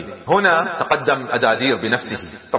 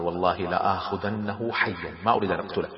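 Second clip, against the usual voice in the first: low, soft inner vow; thinking to himself; 6 semitones lower, 8 dB quieter than usual. A narrator's reading. Khalid privately vows to take him alive, low and soft. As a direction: drop your voice into private resolve. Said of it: "كما هو يفكر".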